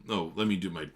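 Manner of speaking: ordinary talk, nothing marked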